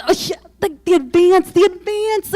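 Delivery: high, loud, repeated mocking emphasis; mock gushing. Mocking the blogger's book deal, high loud mock gush. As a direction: sneer at the advance by mimicking her.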